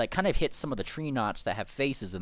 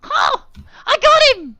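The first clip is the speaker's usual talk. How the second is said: high loud gleeful yell; triumphant shout; 18 dB louder than usual. Acted. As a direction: crow in victory. Character triumphs.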